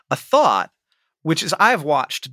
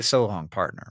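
low, soft cowboy farewell; lightly voiced cowboy drawl; 7 semitones lower, 6 dB quieter than usual. Shown as a demonstration. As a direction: tip your hat and drawl it. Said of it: "it's better if you say".

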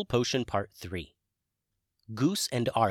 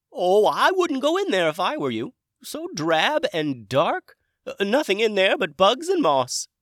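high, loud dragon character voice; Hamish dragon voice; 11 semitones higher, 9 dB louder than usual. Acted. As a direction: play a chirpy, high-pitched dragon wizard. New dragon speaks in story.

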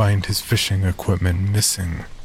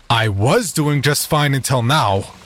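angry retort; 6 semitones higher than usual. Acted. Doctor snaps back angrily.

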